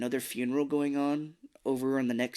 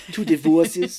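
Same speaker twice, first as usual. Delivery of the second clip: loud, higher mock Austrian accent; Arnold Schwarzenegger; 5 semitones higher, 11 dB louder, slower than usual. Co-host parodying her own impression, loud mock Austrian accent. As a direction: punch it out in a hammed-up Austrian accent. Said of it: "I'm starting to sound like Arnold Schwarzenegger".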